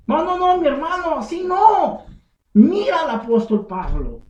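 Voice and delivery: loud, high "no, no, no" of a preacher; firm rebuke. Real, not acted. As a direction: cut them off with a loud preaching no. Preacher rebukes false apostles. (reprensión fuerte y aguda)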